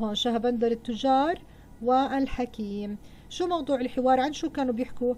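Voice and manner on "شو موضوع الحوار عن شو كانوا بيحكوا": ordinary delivery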